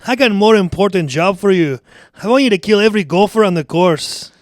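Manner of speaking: forceful Scottish brogue; angry Scottish accent. Acted. Boss ordering the groundskeeper to kill gophers, angry Scottish accent. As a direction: give orders as a cross Scotsman. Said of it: "And he's, and he's angry".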